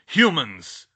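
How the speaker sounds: loud, contemptuous exclamation; nasty voice. A narrator's reading. Centaur confronts trespassers in his forest, nastily. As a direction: spit it out with loud disdain. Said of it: "said in a nasty voice".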